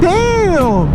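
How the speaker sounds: imitating his own shock; mock stunned. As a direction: blurt it out, caught off guard. Reacting to her insult.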